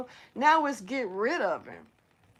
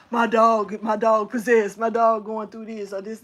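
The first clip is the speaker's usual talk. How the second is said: hammed-up alarm, starting louder; mock paranoid; 4 dB louder than usual. Imitating a paranoid dog owner, mock alarm. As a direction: fake a paranoid owner's fuss. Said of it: "on no paranoid stuff and be like".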